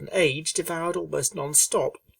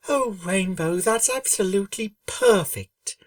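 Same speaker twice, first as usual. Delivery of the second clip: delighted exclamation; 5 semitones higher than usual. A narrator's reading. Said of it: "Fluttershy exclaimed".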